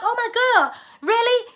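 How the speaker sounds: high, sarcastic fake surprise; mock surprise. Imitating herself pretending to be impressed, sarcastic mock surprise. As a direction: act amazed while not believing them. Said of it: "We'd be like".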